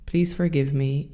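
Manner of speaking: usual speaking voice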